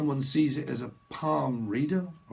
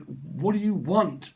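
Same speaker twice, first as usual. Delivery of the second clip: slow, higher, drawn-out demand; mock-exasperated question, each word stretched; acted exasperation; 5 semitones higher, slower than usual. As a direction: lean slowly on the question, fed up. Exasperated server to fussy customer.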